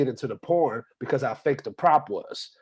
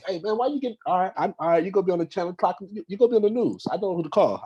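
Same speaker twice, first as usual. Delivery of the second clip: higher, hammed-up street cop bluster; mock cop voice; 7 semitones higher than usual. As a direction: play a swaggering cop warning you.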